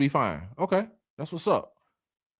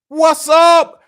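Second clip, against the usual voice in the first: loud, much higher hype shout; shouted greeting; 13 semitones higher, 8 dB louder, much slower than usual. Real, not acted. Livestream host hyping up a viewer's arrival, shouting the greeting. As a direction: yell out a welcome to a viewer.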